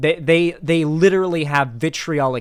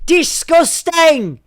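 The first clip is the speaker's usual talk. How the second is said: high, slow screeching Scottish impression; hammed-up Scottish shriek, very high; mock Scottish accent; 14 semitones higher, 7 dB louder, much slower than usual. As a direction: stretch it out in an overdone Scottish screech. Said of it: "she sounded like the".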